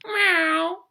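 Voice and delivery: high mock meow; cat meow. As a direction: squeak it like a cartoon cat. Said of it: "They're like".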